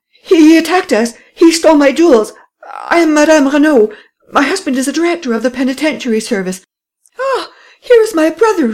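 high, loud, breathless cries in a woman's voice; breathless lady crying out to the police; panting, distraught. A narrator's reading. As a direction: gasp it all out, near hysterics. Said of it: "Panting for breath, the lady exclaimed".

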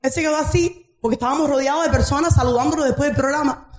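ordinary talk, nothing marked

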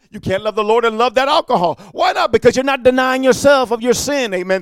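ordinary delivery

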